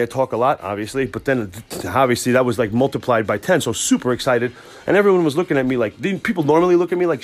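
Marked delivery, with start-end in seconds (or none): none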